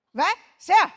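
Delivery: loud blunt "who is it"; gruff phone answer. Shown as a demonstration. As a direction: bark into the phone. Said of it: "我长这么大一直都是这么接电话的呀".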